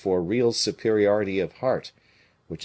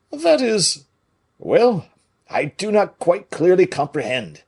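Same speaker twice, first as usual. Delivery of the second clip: higher, louder fumbling musketeer; confused Porthos; 10 semitones higher, 7 dB louder than usual. A narrator's reading. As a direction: stumble over it, puzzled.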